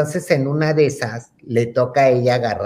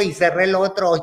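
usual speaking voice

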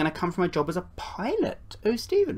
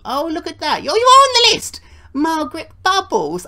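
much higher, louder, fast gushing; delighted squeal; 10 semitones higher, 8 dB louder than usual. Acted. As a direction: gush over good news. Delighted visitor on the list.

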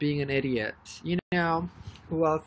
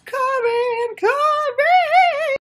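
very loud, high scream of a name; thrilled shout; 8 dB louder, much slower than usual. Real, not acted. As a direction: scream the fan's name in delight. Calling fan name.

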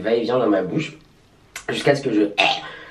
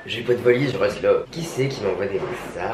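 ordinary talk, nothing marked

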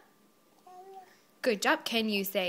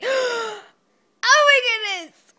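high, rising squeal; excited squeal; 13 semitones higher, 6 dB louder than usual. Shown as a demonstration. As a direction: show the baby's favourite exclamation. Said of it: "Is that why I say".

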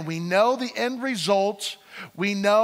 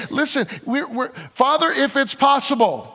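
raised, fervent preacher voice, high and loud; preaching at full force; 5 semitones higher, 3 dB louder than usual. Acted. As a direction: drive the passage home to the congregation.

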